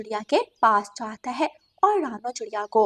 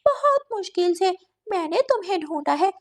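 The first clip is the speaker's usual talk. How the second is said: higher-pitched storybook cat villain; lightly gloating cat voice; scheming cat; 7 semitones higher than usual. Acted. Cat confesses hunger to trapped crow, lightly gloating.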